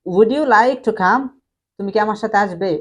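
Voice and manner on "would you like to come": very slow, rising at the end; slow teacher read with a rise; slow model question. Shown as a demonstration. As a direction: lay out the question slowly, lifting you. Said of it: "next হচ্ছে".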